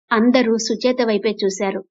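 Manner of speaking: plain reading voice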